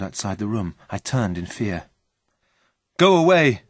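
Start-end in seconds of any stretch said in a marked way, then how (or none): 3.0-3.6 high, loud shout of alarm; alarmed cry. A narrator's reading. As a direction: cry out to drive someone off. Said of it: "I cried".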